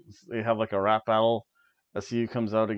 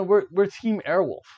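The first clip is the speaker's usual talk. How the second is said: higher and louder, swaggering chant; mock team boast; 7 semitones higher, 5 dB louder than usual. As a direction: announce the team name like a proud wrestler. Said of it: "Just say".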